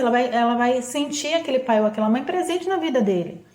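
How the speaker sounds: usual speaking voice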